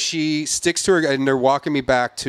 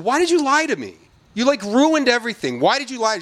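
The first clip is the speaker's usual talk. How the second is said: angry confrontation; 11 semitones higher than usual. Retold or imitated. Confronting the lying hostess.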